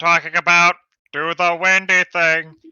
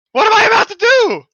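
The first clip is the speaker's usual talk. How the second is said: high, loud and fast, in a panic; panicked yell; 7 dB louder, faster than usual. Acted. Player panicking at the screen, loud fast yell. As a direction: blurt it out in alarm.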